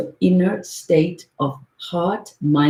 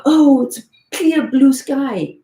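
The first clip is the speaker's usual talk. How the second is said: higher, light, lightly voiced wonder; mock delighted sky; 8 semitones higher, 3 dB louder, slower than usual. The sky pretending to admire wispy clouds, light mock delight. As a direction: coo over the clouds in play. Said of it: "the sky doesn't go".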